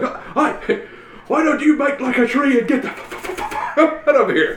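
high, laughing, stuck on f-; stuttering character impression. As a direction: stammer on the f, laughing. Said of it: "he's like".

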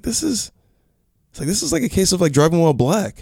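mock public defender. Public defender confiding to his client, high and hushed. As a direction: lift the pitch way up and hush it, confiding. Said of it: "He's like".

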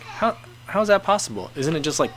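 mock-baffled questioning; incredulous reaction. Acted reaction to retold Korean American stories.

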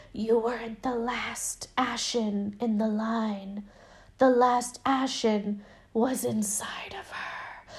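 slow, sinister whisper; hushed, drawn-out creepy reveal; whispering doll. Retold or imitated. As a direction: imitate a demonic doll whispering a secret. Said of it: "I'll whisper it to you".

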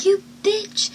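high, soft mock outburst; mock insult. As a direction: imitate an angry boyfriend in a high, hushed voice. Retells angry boyfriend slamming door.

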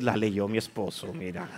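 wife voice. Retold or imitated. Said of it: "va a decir".

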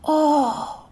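high, drawn-out gasp of a crowd; shocked exclamation. A narrator's reading. Crowd hearing of something so grave, astonished. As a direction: exclaim in long-held astonishment. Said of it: "La gente exclamó con un".

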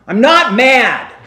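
loud, slow, angry I'm not mad; hammed-up mad voice; angry denial. Shown as a demonstration. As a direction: snap it out like you are furious. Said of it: "do you believe my tone of voice".

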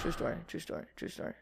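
breathy whispered aside, very soft. Acted. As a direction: murmur it like a secret. Whispered aside after a joke.

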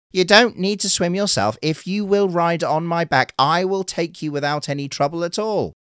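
bright eager reassurance; turtle voice. Acted.

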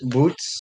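"Boots" with a long oo, rising as a question; questioned pronunciation. Shown as a demonstration. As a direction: ask "Boots" with the oo of "food". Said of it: "Is that how you pronounce it".

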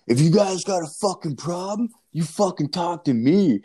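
high, forceful mock threat; imitated angry confrontation. Huge dad confronts guys who mouthed off, imitated angry high voice. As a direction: challenge them as a riled-up dad, voice pitched high.

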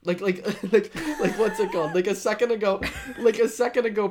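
high and loud, laughing through it. Real, not acted. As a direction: giggle out each like. Laughing while teasing friend.

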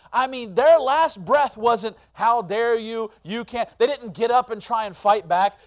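loud, high preaching; impassioned preaching. Real, not acted. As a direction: hammer the contrast. Preaching at full force.

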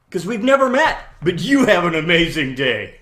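bright loud send-off; cheerful reveal. Retold or imitated. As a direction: beam it out as a blessing.

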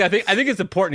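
high, loud voice through laughter; laughing imitation. Voicing a teen turtle reading his list, laughing. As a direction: quote him while cracking up.

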